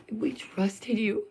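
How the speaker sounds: slow whispered we trusted you; hurt whisper. Real, not acted. Vlogger telling Joe how betrayed she feels, whispered. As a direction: breathe it out, wounded.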